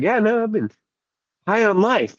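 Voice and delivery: high-pitched imitation of an energetic guy; imitating a buddy's high, lively voice; Angel's voice. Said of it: "He's like".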